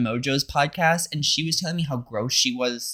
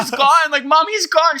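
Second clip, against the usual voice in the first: put-on high, whiny grieving kid; mock childish wail; 12 semitones higher, 4 dB louder than usual. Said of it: "But I was like".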